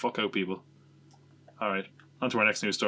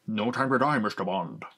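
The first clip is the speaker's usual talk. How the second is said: a hint of a Scottish Connery Bond voice; light Sean Connery accent. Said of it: "I did a Sean Connery accent as Bond".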